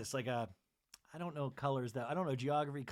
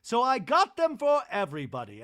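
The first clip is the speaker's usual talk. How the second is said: loud chirpy gift-giver; 4 semitones higher, 7 dB louder than usual. Retold or imitated. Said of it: "Bronwyn's like".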